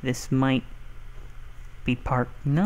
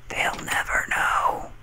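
slow conspiratorial whisper; sneaky hushed aside. Pretend. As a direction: whisper it like a secret. Secretive aside during game.

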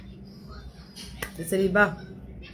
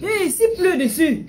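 high, loud shout; high startled call to someone nearby; 11 semitones higher, 7 dB louder than usual. Real, not acted. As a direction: call out across the room. Calling out to someone.

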